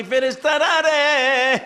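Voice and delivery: high, drawn-out summons; calling the angels. Acted. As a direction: call out to the angels, stretching the last sound. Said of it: "আমার আল্লাহ ডাকি".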